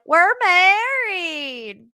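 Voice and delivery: high, slow mock country accent. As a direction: imitate her sudden Southern twang. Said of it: "Colleen got this country accent".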